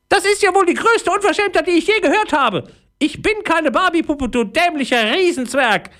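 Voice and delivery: tiny high voice, indignant and scolding; an outraged little fairy telling off a giant; furious fairy. A narrator's reading. Fairy called a Barbie doll scolds Leon furiously.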